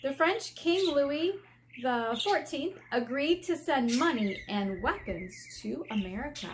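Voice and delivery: normal talking voice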